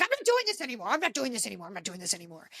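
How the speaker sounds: mock sulky walk-off, dying away; imitating a husband quitting the argument; fading repeated refusal. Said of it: "He's like".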